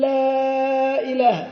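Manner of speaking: لا إله with the l of لا held very long and slowly, chanted; lingering l. Shown as a demonstration. Teacher imitating a tongue stuck on the lam. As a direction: hang on the l of لا before إله. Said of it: "فيبقى على اللام مدة طويلة".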